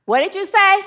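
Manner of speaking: high, disbelieving question; incredulous. Acted. Hiker questions God's alarming order, incredulous. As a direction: ask it as if you can't believe your ears.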